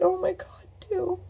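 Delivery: high, choked cry of her friend's name; calling her friend through tears. Retold or imitated. Retelling the phone call to her friend, a high tearful cry. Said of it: "And I said".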